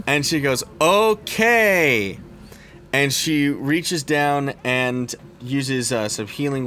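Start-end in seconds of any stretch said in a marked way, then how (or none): 0.8-2.2 high, loud, long-stretched okay; exaggerated "oh-kay" in character; drawn-out okay. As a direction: sing it out slowly, pitch raised then falling. Healer answers the patient's snub.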